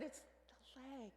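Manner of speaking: hushed worried aside to husband; hushed alarm. Retold or imitated. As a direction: lean in and tell him quietly. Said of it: "I said".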